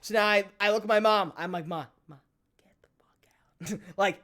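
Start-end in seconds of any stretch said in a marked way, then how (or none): 1.7-3.8 whispered plea to mom; hissed under the breath so nobody overhears; acted hushed warning, barely voiced; urgent whisper. Quietly warning mom to leave.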